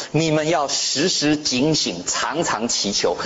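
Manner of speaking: slow, measured reading of the verse; scripture reading. Acted. Pastor reading Luke to the congregation, slow and measured. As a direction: read it out slowly, word by word.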